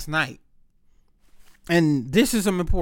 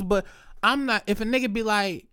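usual speaking voice

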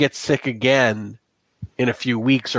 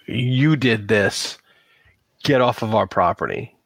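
stern warning. Acted. Angry farmers confront the strangers.

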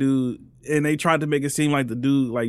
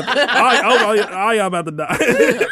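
laughing; 5 semitones higher, 4 dB louder than usual. Pretend. Joking threat.

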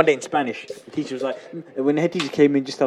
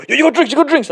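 loud, high eager calling; eager shout; 10 semitones higher, 5 dB louder than usual. Retold or imitated. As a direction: shout it like pushy kids. Kids rushing him for drinks.

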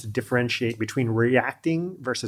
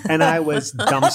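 ordinary talk

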